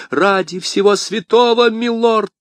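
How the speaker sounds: high-pitched alarmed cry; испуганный вскрик. A narrator's reading. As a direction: cry out in alarm to stop a fight. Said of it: "вскрикнула госпожа Бонасье". (высокий тревожный вскрик)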